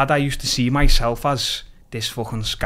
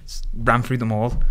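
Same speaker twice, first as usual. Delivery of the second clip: usual speaking voice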